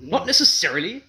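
Not necessarily rising high and loud; incredulous. A narrator's reading. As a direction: echo it in disbelief. Husband incredulous echo.

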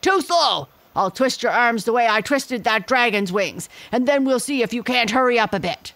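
loud, high snap, then fast; impatient gorilla. A narrator's reading. Gorilla interrupts my father, loud and impatient. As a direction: cut him off and threaten.

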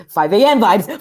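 loud, high celebratory shout; excited cheer. Real, not acted. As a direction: cheer a small victory in the game. Celebrating reaching five A M.

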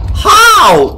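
very high, loud cry; real exasperated shriek; incredulous scream. Real, not acted. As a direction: scream it in disbelief. Reacts to enemies' skill.

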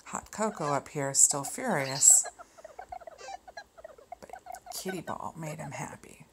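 usual speaking voice

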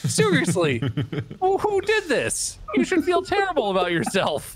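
very high, squealing indignation; comic outrage. Real, not acted. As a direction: wail your disbelief at this awful design. Outraged at badly made game snake.

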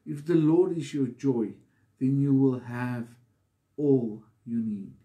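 normal talking voice

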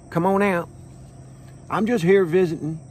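ordinary talk

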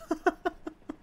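short high giggle; high staccato laugh. Real, not acted. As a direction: giggle in a quick burst at your own joke. Laughs at own smash joke.

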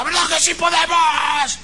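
high, loud motivator shout; wild yelling. Retold or imitated. Motivator rallying store employees, wild shouting. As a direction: imitate a frenzied rallying cry. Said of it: "escuché gritos desaforados de uno que decía".

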